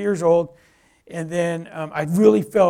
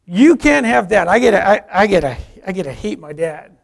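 defiant refusal; 2 semitones higher, 9 dB louder than usual. Retold or imitated. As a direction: refuse God outright, loudly. Retold refusal to God.

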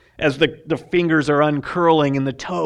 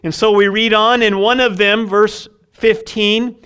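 ordinary delivery

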